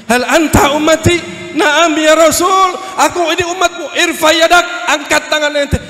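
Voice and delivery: high, loud acted cries; urgent calling out. Prophet and his follower calling to each other in hell, urgent shouts. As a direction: call out across a great crowd.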